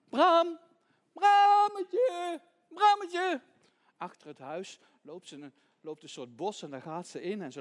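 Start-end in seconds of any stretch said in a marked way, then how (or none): 0.1-3.4 high, loud drawn-out call. Acted. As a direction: call far for a lost lamb. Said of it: "Ze roept".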